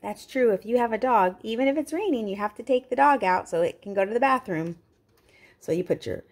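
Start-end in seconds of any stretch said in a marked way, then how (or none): none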